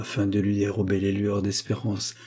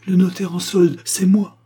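loud, high, ardent; fervent, raised-voice confession; triumphant reveal; 8 semitones higher, 6 dB louder than usual. A narrator's reading. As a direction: announce it with great feeling. Climactic revelation of his identity.

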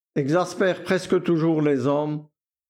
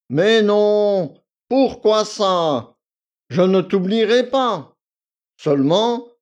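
higher, louder, patronising; condescending valet; 4 semitones higher, 6 dB louder than usual. A narrator's reading. Victor to Akoulina, condescending. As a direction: talk down to her. Victor brushing off her fears.

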